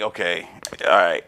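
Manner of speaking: low-pitched, resigned acceptance; retold curt withdrawal. Retelling how he checked out of the conflict, low resigned reply. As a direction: shut the argument down without fighting.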